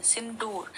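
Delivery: Sindoor with the weight on दू, said quietly; Sindoor with a soft सिं and a stressed दूर. Shown as a demonstration. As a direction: put the push on दू in Sindoor. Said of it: "सिंदूर में भी द में हम जोर डाल रहे हैं".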